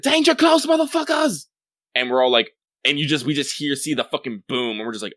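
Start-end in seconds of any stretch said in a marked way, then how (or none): 0.0-1.5 high shouted warning over the radio; clone soldier radio yell. Retold or imitated. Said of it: "we hear the Clone Boys saying".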